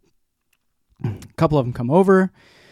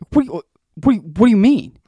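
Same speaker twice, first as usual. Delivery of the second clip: stammering, high-pitched retold disbelief; reenacted stunned confusion, pitched up; baffled alarm; 7 semitones higher, faster than usual. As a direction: act out stumbling over shocking news. Retells reacting to hospital news.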